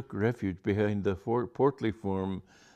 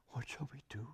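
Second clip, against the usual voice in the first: whisper; 5 semitones higher, 16 dB quieter than usual. A narrator's reading. Whispered in hiding.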